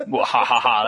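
hammed-up villain cackle; mock evil laugh, twirling mustache. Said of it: "as I twirl my mustache".

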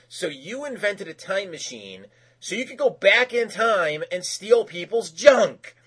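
exasperated, drawn-out ending; retold angry wife. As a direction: imitate an incredulous wife telling off her husband. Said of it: "so now she's doubly mad".

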